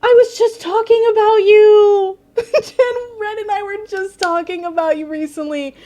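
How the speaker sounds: excited greeting. Real, not acted. Surprised greeting of friend Jen.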